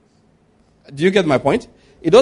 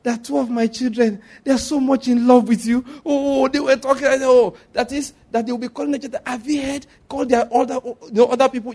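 imitated excited shouting; 7 semitones higher than usual. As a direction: gush on and on in a high voice. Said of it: "She started shouting".

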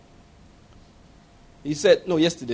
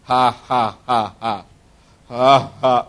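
dry forced laugh; 8 semitones lower, much slower than usual. Retold or imitated. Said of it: "So he began to laugh".

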